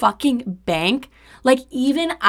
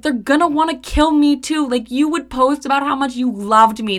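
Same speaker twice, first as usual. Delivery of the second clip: higher, louder anxious rush; frantic worry; 4 semitones higher, 3 dB louder than usual. Retold or imitated. To her ex about his Instagram posts, frantic and higher. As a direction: play up the panic. Fears the hitmen will target her.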